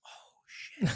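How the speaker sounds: breathless whispered gasp of dismay; shocked realization. Retold or imitated. Retelling seeing the smashed building, whispered shock. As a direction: whisper it as the damage sinks in.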